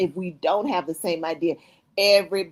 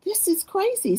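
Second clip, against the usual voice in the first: retold disbelief; 11 semitones higher than usual. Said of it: "I'm like".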